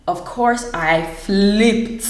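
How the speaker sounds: usual speaking voice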